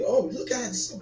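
normal talking voice

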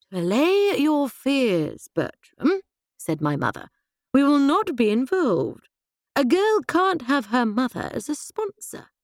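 a hint of a brisk, diverted upper-class mother; mother calling her husband to order; lightly voiced mother. A narrator's reading.

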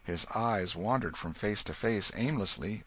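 usual speaking voice

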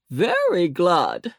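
put-on slow, drawn-out old gentleman voice; old rabbit gentleman. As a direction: savour the words slowly. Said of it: "answered Mister Longears".

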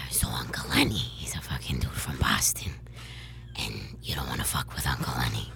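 whispery Boston tough-guy voice; Uncle Lenny. Shown as a demonstration. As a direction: rasp it in an unvoiced Boston whisper. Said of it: "Trying to hear Uncle Lenny".

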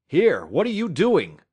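sudden cry. Acted. Scott shouting at Matt grabbing the rifle, sudden loud cry. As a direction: cry out in alarm. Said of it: "Scott cried suddenly".

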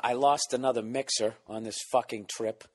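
ordinary delivery

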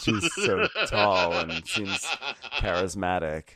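talking through real laughter; drawn-out words broken up by laughs; laughing. Real, not acted. Podcaster cracking up at a co-host's joke, laughing.

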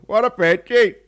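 put-on high, loud caricatured Belfast accent; hammed-up Belfast greeting. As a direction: holler an exaggerated Belfast hello. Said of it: "how many people actually go".

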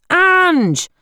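very high, loud yell; yelling a name. Acted. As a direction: belt her name across the hall. Uncle yells her name again.

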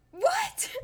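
very high shocked yelp. Retold or imitated. Kids learn mom put the dog down, high shocked what. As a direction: squeal it in disbelief.

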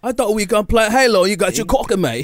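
high, fast British bloke; British accent. Acted. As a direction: camp British lad voice. British friend voice.

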